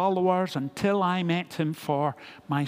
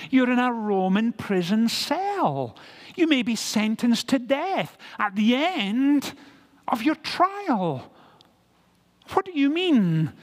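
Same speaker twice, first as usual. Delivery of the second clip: higher-pitched, mounting disbelief; incredulous challenge; 6 semitones higher than usual. Acted. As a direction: press Paul with pointed, emphatic questions. Acted Philippians challenging imprisoned Paul.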